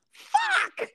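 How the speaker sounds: one high, drawn-out "Fuck." of dawning horror; the moment it all clicks; very high, stretched-out groan of a word; dismayed realization. Retold or imitated. Realizing she peed on the floor.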